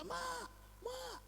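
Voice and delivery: high child's voice calling Mak, softly mocked; a lost child calling for mom, pitched high. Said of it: "dia teriak-teriak".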